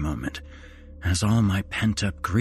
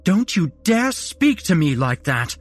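higher, louder furious mother scolding; sharp rebuke; 10 semitones higher, 6 dB louder than usual. A narrator's reading. Mother scolding her son for his rudeness, sharp and furious. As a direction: snap at him like a mother putting a child in his place. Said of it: "her sharp rebuke".

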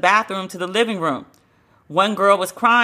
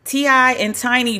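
ordinary talk, nothing marked

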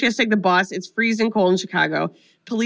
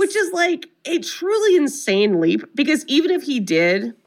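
incredulous; 6 semitones higher than usual. Real, not acted. Outraged at the police's accusation.

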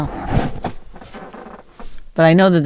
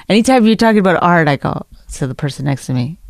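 ordinary delivery

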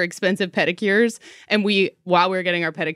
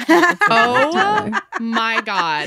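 laughing; much slower than usual. Real, not acted. Cracking up at the photos.

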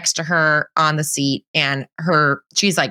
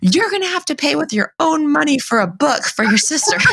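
mock angry mom; 8 semitones higher than usual. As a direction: snap at the toddler from the front seat, hammed-up. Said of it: "turn to the back to my three-year-old and go".

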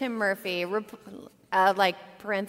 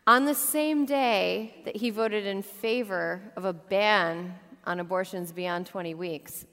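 ordinary talk, nothing marked

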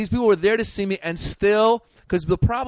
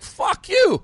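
much higher, louder yelled curse; angry retort; 4 dB louder than usual. Retold or imitated. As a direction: snap it back at the rude guy. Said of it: "I'm like".

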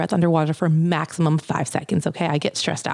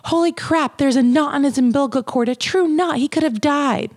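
much higher, stretched, shocked holy crap; shocked doctor; 8 semitones higher than usual. Retold or imitated. Doctor discovering a true knot in the cord, shocked. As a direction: gasp it out in disbelief. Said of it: "one of the first things I hear".

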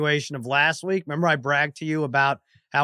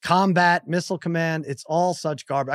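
ordinary talk

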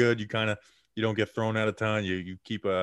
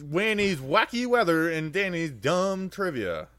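announcer voice; 6 semitones higher, slower than usual. Acted. Mock segment title.

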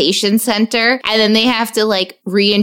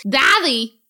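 imitated kid yelling "Daddy" with a thick th-like d; higher, squawked little-boy call; toddler's "daddy"; 5 semitones higher, slower than usual. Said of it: "That's how he says daddy".

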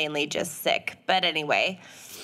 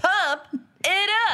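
high laughing hype chant; hyped up; 10 semitones higher than usual. Pretend. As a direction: pump the crowd up, laughing. Hyped repetition game.

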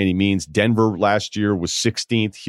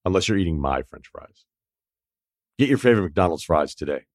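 dramatic ad read; 3 semitones lower than usual. Acted. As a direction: savour each line like a confession. Reads McDonald's ad.

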